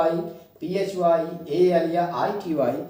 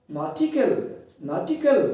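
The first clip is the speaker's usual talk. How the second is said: Nautical with a high peak before the falling end; stress on second-last syllable; 6 semitones higher, slower than usual. Shown as a demonstration. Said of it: "Stress on second last syllable". (Nautical में आख़िर से दूसरे syllable पर ज़ोर)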